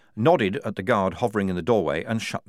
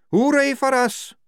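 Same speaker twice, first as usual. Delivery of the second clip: sarcastic; 6 dB louder than usual. A narrator's reading. Tonka, bitter about their own side.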